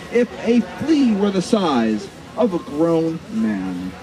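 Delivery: ordinary delivery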